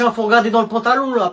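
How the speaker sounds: normal talking voice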